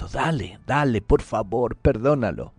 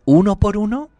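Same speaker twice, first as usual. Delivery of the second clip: much higher and louder, rising; fervent exhortation; 11 semitones higher, 6 dB louder than usual. Acted. Preacher presses listeners to forgive each person, with fervor. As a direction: urge them on with rising fervor. Preacher repeats the phrase, escalating.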